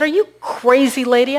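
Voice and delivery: high, loud acted disbelief; incredulous protest. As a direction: balk at her loudly. Homeless man balks at woman's plan.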